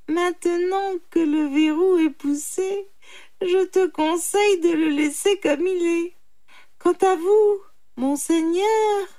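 high, loud animal voice; triumphant jackal. Acted. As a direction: address him slyly, pitched high. (chacal triomphant, voix aiguë)

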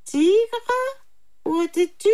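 high little animal voice; questioning jackal. A narrator's reading. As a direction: quiz him, pitched high. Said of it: "reprit le chacal". (chacal curieux, voix aiguë)

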